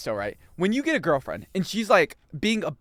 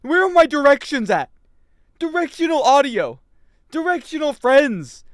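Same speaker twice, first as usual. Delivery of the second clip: loud, high mock outrage; exasperated scolding; 9 semitones higher, 7 dB louder than usual. Gamer berating Rafik over directions, mock exasperated. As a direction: berate a useless driver at full voice.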